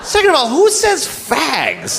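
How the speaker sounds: high-pitched, scandalised question; indignant retort. Acted. Comedian objecting to the president's slur, high and indignant. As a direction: challenge it in mock disbelief, pitched high.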